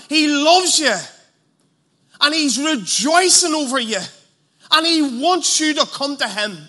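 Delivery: loud, high, fervent preaching; rising revival preacher voice; preaching at full force. Acted. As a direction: preach it out to the whole hall. Preacher building an altar call.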